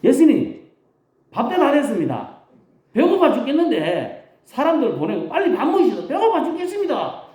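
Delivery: high, pleading, laughing complaint; hungry whining disciples. Retold or imitated. Disciples whining to Jesus that they're starving, high and laughing. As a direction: whine to Jesus that you're starving, half laughing. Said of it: "우리 버전으로 번역을 해봤습니다".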